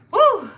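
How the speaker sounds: a loud, high woo to shake off emotion; high whoop. Real, not acted. Reader whoops to gather herself, loud high woo. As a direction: let out a big woo. Bracing before emotional topic.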